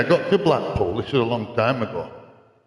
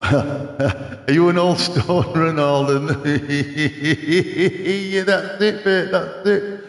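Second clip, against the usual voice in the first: amused, stretched words broken up by laughter; laughing; 4 semitones higher, much slower than usual. Real, not acted. Streamer tickled at a viewer asking about stoners, laughing. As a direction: talk through a high, drawn-out giggle.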